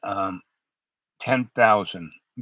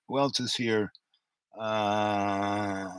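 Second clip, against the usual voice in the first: ordinary talk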